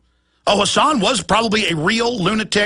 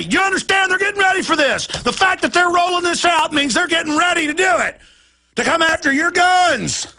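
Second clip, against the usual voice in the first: furious shouting; 10 semitones higher than usual. Real, not acted. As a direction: shout out the outrage for real. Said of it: "why I'm so upset right now".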